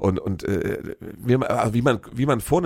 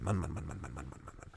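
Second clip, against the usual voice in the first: low mutter fading almost to nothing; weary, dropping aside to yourself; exasperated muttering; 8 semitones lower, 15 dB quieter than usual. Real, not acted. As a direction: grumble it under your breath, trailing away. Head-shaking aside about the minister's plan.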